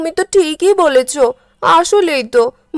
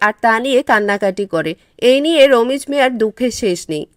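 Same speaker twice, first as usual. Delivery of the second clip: low, even storyteller voice; narrating a village folk tale; story narration; 3 semitones lower than usual. A narrator's reading. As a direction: tell the tale calmly to listeners. Story narrator introduces the fisherman.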